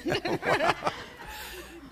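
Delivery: bursting into a high laugh; a loud, high burst of laughter. Real, not acted. Speaker laughing at her own joke about schools, high and loud.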